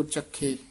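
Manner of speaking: ਚਖੇ with a moderately pressed kh; medium firmness. Shown as a demonstration. Teacher modelling the word, ਚਖੇ moderately firm. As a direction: say ਚਖੇ neither hard nor loose. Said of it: "ਦਰਮਿਆਨਾ ਜਿਹਾ ਬੋਲ ਦੋ".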